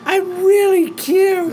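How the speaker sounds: high, slow put-on girl voice; girly voice. Joining the girl caller bit.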